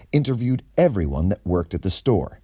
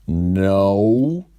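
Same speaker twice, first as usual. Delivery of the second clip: louder, put-on clerk voice; a quick nervous no; store manager voice; 5 dB louder than usual. Kenny answers the detective, louder. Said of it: "answered Kenny".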